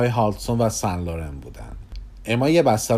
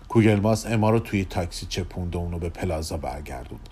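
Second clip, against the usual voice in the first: ordinary delivery, nothing marked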